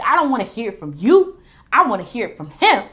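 imitating angry Roman. Reigns rejecting Heyman's plea, loud imitated anger. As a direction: bark it like a furious champion. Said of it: "Roman Reigns was like".

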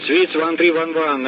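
ordinary talk

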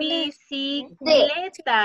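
Bicicleta stretched out very slowly, syllable by syllable; teacher modeling Bicicleta for the class, very slow; very slow syllable demo. Shown as a demonstration. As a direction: drag out Bicicleta to find the stressed syllable. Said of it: "Cuál vendría siendo la sílaba tónica".

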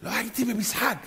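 imitating a hoarse fan; croaky, shouted-out voice; lost voice. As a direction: strain it out through a blown throat. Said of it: "נכנס לך בתוך הגרון".

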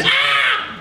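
short loud mock scream "Ah"; a quick hammed-up scream. Nancy fleeing the tiger, brief mock scream. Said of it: "she screams".

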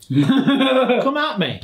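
laughing mock challenge.

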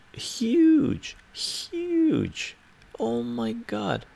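very high, drawn-out squeal of amazement; exaggerated emphasis. Real, not acted. Streamer hyping a huge event to viewers, high-pitched exaggeration. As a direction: stretch it out way up high, far above normal voice. Hyping how big it was.

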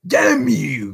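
high, harsh ruffian's curse; desperate cry. A narrator's reading. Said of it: "cried the desperate ruffian".

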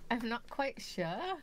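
put-on slow, wobbly British-ish accent; would-be British accent. Shopkeeper asked about the voice, uncertain British accent. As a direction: draw it out in dubious British. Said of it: "Is that a British accent?".

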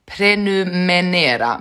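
"Prenumerera" slowly said as "pre-nu-me-ne-ra", N for R; slow, careful "Prenumerera" with a wrong N: "prenumenera"; mispronounced prenumerera. Real, not acted. Streamer practising the word, slowly mispronouncing "Prenumerera" as "prenumenera". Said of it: "Men det var det jag sa".

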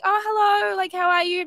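high, loud, breezy hello; friendly mock greeting. Acting out friendly chat with local cop.

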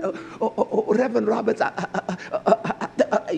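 soft, laughing flustered stammer; mock the flustered woman tripping over her words; imitating the facility president caught off guard, soft and stammering; tongue-tied stammer. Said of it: "she got tied tongue".

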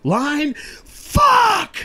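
high, loud cry of frustration; frustrated yell. Retold or imitated. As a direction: blurt it out at yourself. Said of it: "he'd be like".

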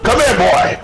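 high, loud challenge to the enemy; playful battle taunt; taunting shout. Pretend. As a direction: call him out to fight.

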